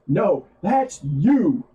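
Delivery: high, stretched-out mock accusation; retold punchline retort. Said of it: "And he goes".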